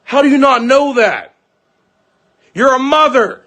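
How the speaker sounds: high-pitched, incredulous reproach; indignant scolding. Retold or imitated. Father retelling how he scolded the mother, indignant.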